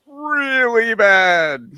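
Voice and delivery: stretched high, slow whine of disgust; really bad, said high and slow in disgust; mock disgust. Real, not acted. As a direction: drag it out like it's gross. Exaggerated disgust at cooked fats.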